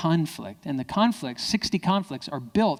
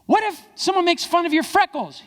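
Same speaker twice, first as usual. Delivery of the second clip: hammed-up drill question; 5 dB louder than usual. Dad drilling son.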